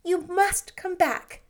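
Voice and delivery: earnest pleading; imploring. A narrator's reading. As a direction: beg him to stay. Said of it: "she said imploringly".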